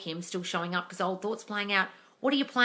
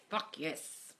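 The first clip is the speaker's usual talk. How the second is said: whispered; 4 semitones lower, 11 dB quieter than usual. Real, not acted. Aside after cheering.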